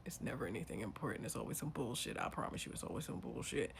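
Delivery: low, quiet, weary-of-it aside; dismissive aside. Real, not acted. Cutting into the ex's big announcement, dismissive undertone. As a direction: drop low and soft to dismiss it.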